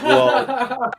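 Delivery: high laughing "Well"; laughing as he quotes Ray. Retold or imitated. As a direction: burst out laughing on the word.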